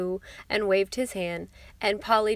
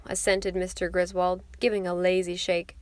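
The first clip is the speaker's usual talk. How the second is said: usual speaking voice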